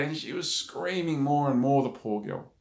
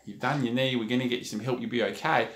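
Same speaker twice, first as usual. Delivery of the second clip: lower, softer, soothing retelling; calm reassurance; 4 semitones lower, 5 dB quieter, faster than usual. Retold or imitated. Lady reassuring the hurt player, calm and low. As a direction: reassure quietly, keeping it low. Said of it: "saying pretty much the same words".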